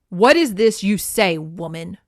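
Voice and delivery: loud opening, sinking onto the last word; sneering demand. A narrator's reading. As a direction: challenge her with a storybook villain's sneer. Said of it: "he sneered".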